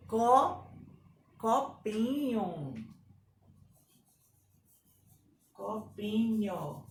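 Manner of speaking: slow, measured "copinho"; syllable-by-syllable dictation. Shown as a demonstration. Teacher dictates word by syllables.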